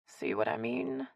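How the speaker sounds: soft, slow muttered aside; hushed, knowing aside; under her breath. A narrator's reading. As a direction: mutter it privately to one listener. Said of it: "said under her breath".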